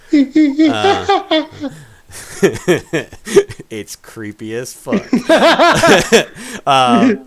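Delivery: breaking up laughing mid-scene; cracking up at how creepy it is. Real, not acted. Game master laughing at his own creepy scene, giggling.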